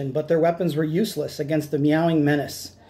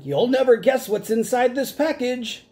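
shouting; 7 semitones higher than usual. Acted.

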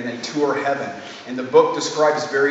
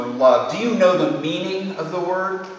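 higher, louder, theatrical tragic voice; grand tragic wailing; 7 semitones higher, 5 dB louder than usual. A narrator's reading. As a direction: declaim it like a ham tragedian. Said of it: "then a few notes deeper".

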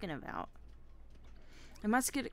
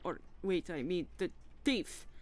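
chef character voice; 3 semitones higher, 4 dB louder than usual. Acted.

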